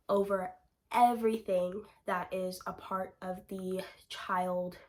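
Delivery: ordinary delivery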